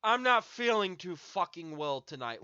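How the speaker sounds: calm with menace. Retold or imitated. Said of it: "as calmly as I am right now with that fucking inflection".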